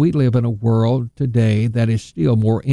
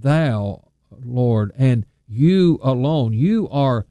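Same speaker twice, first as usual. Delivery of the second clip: you alone and you are stressed, slowed; Peter's reply, stressing you; emphatic you; slower than usual. Retold or imitated. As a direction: lean on each you like Peter's confession. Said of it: "that emphatic".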